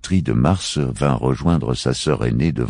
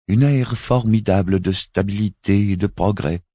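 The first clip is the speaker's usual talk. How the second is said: higher, measured woman's voice; a solemn alien envoy, voiced higher; Miaït's voice; 7 semitones higher than usual. Miaït continues in the same voice. (voix aiguë et posée)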